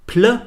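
"Pl" alone, cut off from the word; isolated consonant cluster. Shown as a demonstration. Teacher breaking Platz into its consonants, isolated sound. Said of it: "besteht bereits aus vier Konsonanten".

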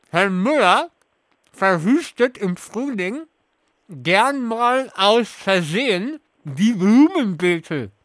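talking through a mouthful of marshmallows; slow, stuffed-cheek speech; mouth full. Real, not acted. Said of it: "mit vollem Mund alle Sätze vor".

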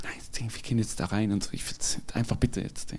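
hushed, fast whisper, pleading; mother's urgent whisper. Retold or imitated. Son retelling his mother whispering near the bank, hushed and urgent. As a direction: whisper it quick so the banker won't hear. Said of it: "Die so".

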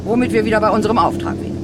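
brisk eager. Acted. Leader steering ghosts to the mission, fast eager push. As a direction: rush on to the mission.

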